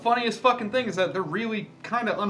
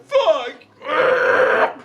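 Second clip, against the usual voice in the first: high, loud laughing curse; loud outburst; 9 semitones higher, 8 dB louder than usual. Real, not acted. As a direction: blurt it out, cracking up. Outburst while laughing at pufferfish.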